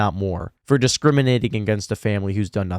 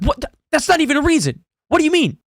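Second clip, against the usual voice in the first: higher and fast, a disbelieving squeal; baffled kid; 14 semitones higher, faster than usual. Retold or imitated. Kid protesting a parent's non-answer, high and fast. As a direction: rattle it off high in exasperated disbelief. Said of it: "It's like".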